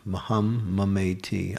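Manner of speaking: ordinary talk, nothing marked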